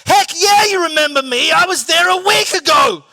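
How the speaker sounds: high, loud mock shout; exaggerated shout. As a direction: yell it the way his head said it. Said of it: "I didn't say it like that".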